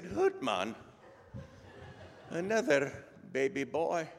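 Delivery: mock-dismayed Scottish reply; Scottish brogue. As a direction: echo the news haltingly, with a pause, in Scottish brogue. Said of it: "best Scottish brogue I can come up with".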